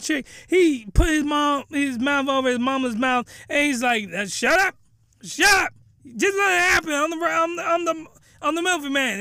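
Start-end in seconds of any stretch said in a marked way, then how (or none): 4.1-9.2 frantic hushing. Acted. The son silencing his mother.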